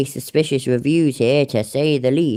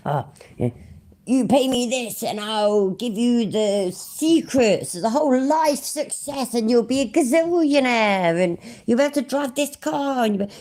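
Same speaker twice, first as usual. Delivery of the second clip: mock guru salesman; 8 semitones higher than usual. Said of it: "one of them saying".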